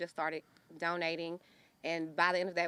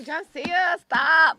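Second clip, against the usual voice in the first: high, loud call to stop; playful shout; 10 semitones higher, 13 dB louder, slower than usual. Real, not acted. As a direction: shout it out, half laughing. Fighting tears, calling out to stop.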